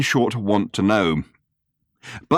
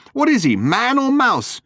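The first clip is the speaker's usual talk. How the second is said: slowed, exasperated crescendo of questions; outraged cry; 7 semitones higher than usual. Retold or imitated. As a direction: clamour like indignant fans wanting gossip. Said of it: "comes the cry".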